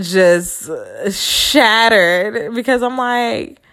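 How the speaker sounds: ordinary talk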